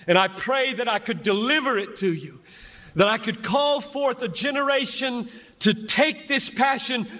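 high, ringing sermon cadence; impassioned preaching. Acted. Preacher rallying students to the hard places, fervent. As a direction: call the generation up with fervour.

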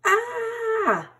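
startled yelp. A narrator's reading. Hedgehog trips over shovel.

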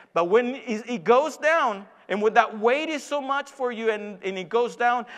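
loud, insistent rhetorical questioning; impassioned preaching. Acted. Preacher voicing God to the struggling believer, forceful preaching. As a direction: press each question on the congregation.

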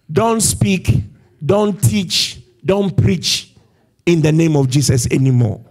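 retold warning, firm and emphatic; acted-out authority forbidding them; stern command. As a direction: lay down the order forcefully. Council forbidding the apostles to preach.

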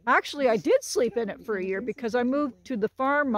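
ordinary delivery

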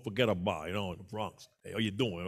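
put-on low, soft New York accent; lightly put-on Bronx accent; mock Bronx accent. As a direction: toss it off like a Bronx local. Imitating Bronx New Yorkers.

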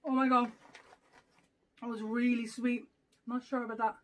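talking between bites; chewing sweet crunchy snack; mouth full chewing. Real, not acted. Seen 1: bit piece at -5, chewing while talking, bites again at 3.0.

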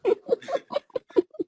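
squealing laugh, rising; high laugh. Real, not acted. Laughs at own joke.

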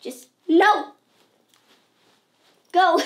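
loud, slow, exasperated get-away; loud fed-up yes I'm fine; annoyed brush-off. Retold or imitated. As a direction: shoo everyone off, loud and slow. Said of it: "It's just like".

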